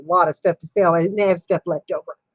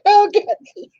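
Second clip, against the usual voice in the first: high, helpless ohs between laughs; real flustered giggling, pitched way up; embarrassed laughter; 10 semitones higher, much slower than usual. Real, not acted. Woman teased by Kathy, embarrassed laughter. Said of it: "Now you've embarrassed me".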